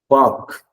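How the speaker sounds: Park said pahk, no R sound; park without R. Shown as a demonstration. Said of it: "You don't say the letter R".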